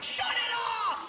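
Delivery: high, drawn-out mock yell; stretched high-pitched holler; yelled order. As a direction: imitate a cop yelling over music. Cop yells at the car.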